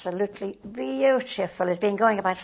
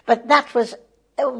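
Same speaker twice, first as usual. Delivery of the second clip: usual speaking voice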